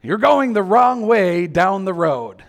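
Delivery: a navigator's blaring alert; GPS warning. Retold or imitated. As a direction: announce it like a nav alert. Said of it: "blaring red".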